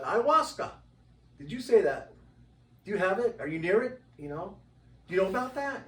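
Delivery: bursting with curiosity; retold excited probing; eager questioning. Eager questions about ayahuasca.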